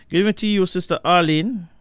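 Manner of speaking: high and loud hello; loud greeting. Real, not acted. Host hailing a listener on air, loud and high. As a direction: call out to her brightly.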